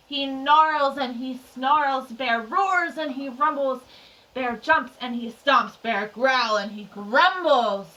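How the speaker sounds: slow, emphatic narration of a raging bear; dramatic storytelling. A narrator's reading. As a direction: punch each verb, drawing it out. Narrates the bear's rage.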